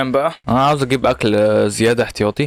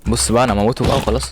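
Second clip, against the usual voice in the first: normal talking voice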